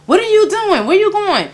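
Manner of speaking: high, alarmed questions; imitating parents rushing in; mock alarmed parent. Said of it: "running like".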